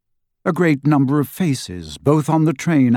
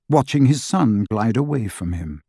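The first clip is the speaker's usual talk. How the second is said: usual speaking voice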